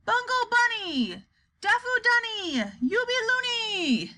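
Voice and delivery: high, drawn-out crazy cries; forceful scream. A narrator's reading. Wonka forcing his voice till he lifts off, high scream. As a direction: force each cry up high and stretch it. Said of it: "he put so much force into his voice".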